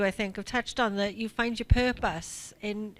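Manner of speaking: usual speaking voice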